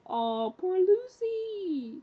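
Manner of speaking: mock pity. The vlogger pitying heartbroken Lucy, slow and high. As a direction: stretch it out in exaggerated sympathy. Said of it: "I'm like".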